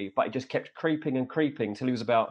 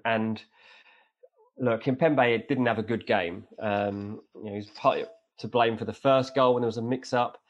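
normal talking voice

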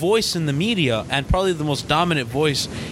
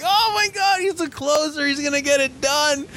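much higher, breathless-with-hope fan squeal; excited fan reenactment; 10 semitones higher than usual. Retold or imitated. Host reliving fans tracking the plane, high and excited. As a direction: relive the thrill, pitched way up. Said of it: "and we're like".

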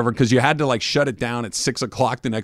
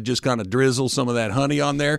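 put-on Southern accent. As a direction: play a Southern cook at ease. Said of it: "hit me with that Southern accent".

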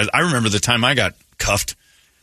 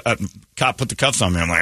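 ordinary talk, nothing marked

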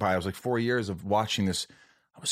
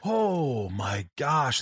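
long, drawn-out gasp of disbelief, pitched up; acted shock; 4 semitones higher, much slower than usual. Reliving the jolt of sudden grief, drawn-out mock shock. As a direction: stretch it out as if jolted back. Acts out being jolted by grief.

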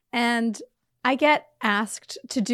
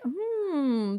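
higher, softer mock "hmm"; gently mocking thoughtful hum; imitated pondering; 5 semitones higher, 5 dB quieter than usual. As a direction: ponder it quietly, imitating a listener. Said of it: "it's gone from, like, listening".

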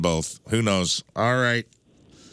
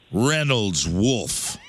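slow announce; much slower than usual. Acted. Host reads quiz name dramatically.

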